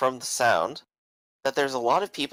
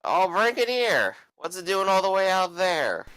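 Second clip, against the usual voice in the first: put-on higher, slurring rowdy drunk; 8 semitones higher, 5 dB louder than usual. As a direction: play a belligerent tavern drunk.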